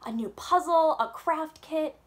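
high, loud eager guesses; excited guessing. A narrator's reading. Girl guessing her present, excited and loud. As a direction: bounce with excitement.

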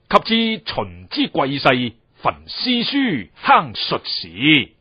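put-on slow, measured reading of old text; slow classical recitation. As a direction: recite each phrase slowly, like a quoted classic. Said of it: "邊亦都有講明".

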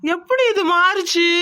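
high, slow, drawn-out amazement; bewildered shock. Acted. Schemer finding his evidence photo swapped, bewildered shock. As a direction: stare at it, stretching each word in disbelief.

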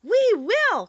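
high, loud, eager squeal; shrill cry. A narrator's reading. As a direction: cry out shrilly like a chorus of mice. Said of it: "cried all the mice in a shrill chorus".

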